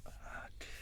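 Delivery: breathy hushed ah dear; whispered dread. Retold or imitated. Dreading the lunch talk with the boss, whispered. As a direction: whisper it under your breath in dismay.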